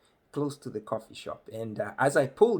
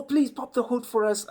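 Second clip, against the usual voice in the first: eager local; 11 semitones higher, 4 dB louder than usual. Retold or imitated. Locals begging to see engine.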